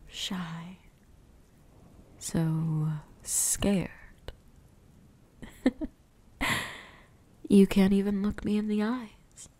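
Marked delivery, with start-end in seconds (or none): 5.4-5.9 short, breathy laugh under the breath; a brief amused chuckle. Acted. Vampire toying with frightened little mouse, brief chuckle.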